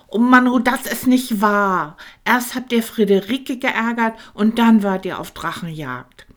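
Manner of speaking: loud, high, indignant little dragon; really worked up at them. A narrator's reading. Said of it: "Tankino war richtig erbost". (Tankino empört sich laut über die Drachenjagd)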